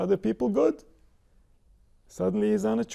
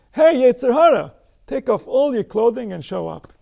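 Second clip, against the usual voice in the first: loud summons to the Yetzer Hara; calling out; 5 semitones higher, 8 dB louder than usual. Pretend. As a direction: call him out boldly, daring him to appear. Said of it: "So if I say to the Yetzer".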